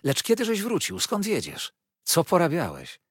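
high-pitched volley of questions; excited, impatient curiosity; eager questioning. A narrator's reading. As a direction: pelt a friend with questions, no wait for answers. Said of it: "Zarzucał mnie pytaniami, nie czekając odpowiedzi".

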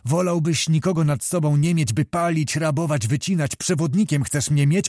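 ordinary delivery

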